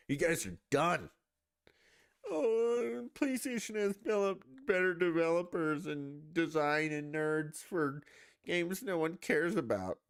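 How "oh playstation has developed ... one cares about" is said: high, mocking nerd voice; high whiny mock fan voice; mocking fanboy. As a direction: sneer it like a smug fanboy. Mocking PlayStation fans.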